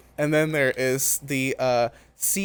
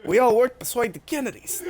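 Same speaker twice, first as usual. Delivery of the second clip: a bad Boston accent, pitched higher; hammed-up Boston local, badly faked; terrible Boston accent; 8 semitones higher than usual. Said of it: "that was a terrible Boston accent".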